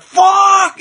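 loud, high-pitched cry of pain; retold agonized scream. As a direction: yell it like slamming a hand on the bench.

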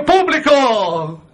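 slow, drawn-out hail to the audience; drawn-out call to the crowd. Acted.

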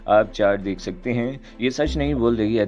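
ordinary delivery, nothing marked

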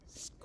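imitated rusty gate screech; a high creaking screech; creaky gate screech.

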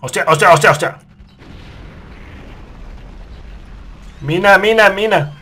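loud, high frantic repeated cries; panicked cursing. Real, not acted. Player fleeing a mine, frantic loud cries. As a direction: spit it out in a panic.

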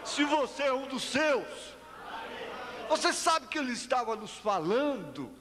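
exclamações agudas de pregador; pregação fervorosa. Real, not acted. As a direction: proclame em tom alto e agudo para a congregação. Clímax da pregação. (pregação exaltada, voz aguda)

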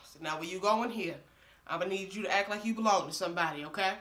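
ordinary talk

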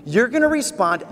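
usual speaking voice

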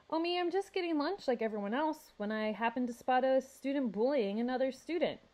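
high, breezily confident reply. A narrator's reading. Mysterious kid explains himself to the rhino, confidently. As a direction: answer the challenge with easy assurance. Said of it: "said with confidence".

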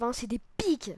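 normal talking voice

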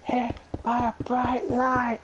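high-pitched mock storytelling; put-on silly voice. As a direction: pitch it up and stretch it out playfully. Carries on the silly voice.

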